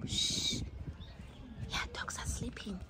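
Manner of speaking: hushed whisper. Real, not acted. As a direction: whisper so the dogs sleep. Hushing near sleeping dogs.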